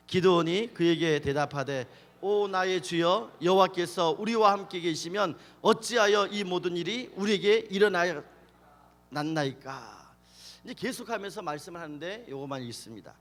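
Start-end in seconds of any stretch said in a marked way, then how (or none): 0.1-10.1 loud, measured scripture reading. Real, not acted. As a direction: read the verse out firmly to lead the room. (크게 또박또박 성경 봉독)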